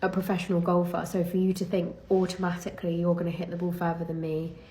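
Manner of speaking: normal talking voice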